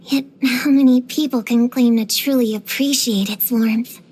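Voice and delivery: slow, low, solemn recital; grave and measured, with weight on each phrase; poetic declamation. Acted. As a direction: intone it slowly like desert poetry. Poetic musing on desert extremes.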